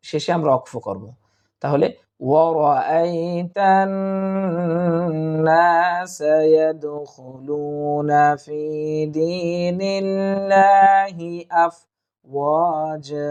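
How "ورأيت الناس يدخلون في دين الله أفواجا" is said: slow, drawn-out chanted Arabic recitation; Quran recitation. Shown as a demonstration. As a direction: recite each word long and melodic. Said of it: "এবার reading পড়ব".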